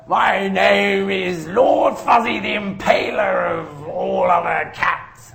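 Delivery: high, slow, loud grand proclamation as a cat; hammed-up regal cat boast; Fuzzy pompous. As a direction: announce yourself like a self-important cat lord. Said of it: "him going".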